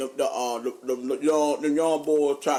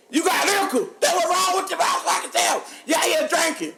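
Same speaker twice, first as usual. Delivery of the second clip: mocking them; 12 semitones higher than usual. Friends teasing him about drinking, high mocking shout.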